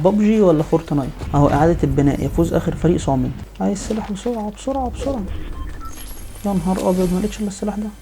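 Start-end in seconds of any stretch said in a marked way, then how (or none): 3.6-7.9 higher, softer frantic pleading; frantic hurry. Real, not acted. Gamer desperate for a weapon, frantic and high. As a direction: rush it, breathless and urgent. Scrambling for weapon under fire.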